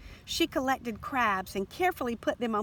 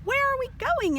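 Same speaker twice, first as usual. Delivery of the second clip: high excited question; 5 dB louder than usual. A narrator's reading. Girl asks while running.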